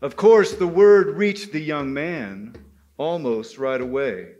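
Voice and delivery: usual speaking voice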